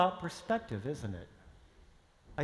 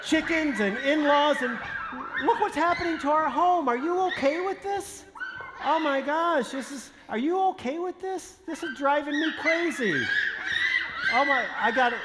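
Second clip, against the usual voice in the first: exasperated laughing; 7 semitones higher than usual. Acted. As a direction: laugh it out in frantic disbelief. Exasperated husband among chickens.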